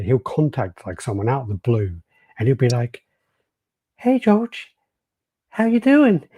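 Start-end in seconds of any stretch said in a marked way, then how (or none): 4.0-6.3 very high, louder put-on greeting; impression of a caller. Caller contacts someone out of the blue, high put-on voice. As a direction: pitch it way up and draw out the hello. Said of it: "that's, like, my impression of him".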